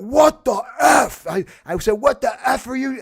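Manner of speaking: high, loud shouted what the F; angry shout. Retold or imitated. Retelling yelling at salesmen at his door. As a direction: yell it at the door in outrage. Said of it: "I went".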